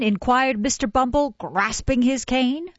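ordinary delivery

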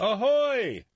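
high, loud sailor's ahoy; swaggering sailor hailing loud; hearty hail. Acted. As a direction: hail the town from the wagon. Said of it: "came a voice from the mighty machine".

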